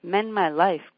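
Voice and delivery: crying out. Acted. Quoted voices cry in poem.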